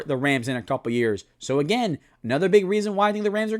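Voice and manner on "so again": ordinary delivery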